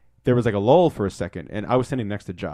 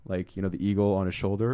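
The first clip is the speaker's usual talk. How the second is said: ordinary talk, nothing marked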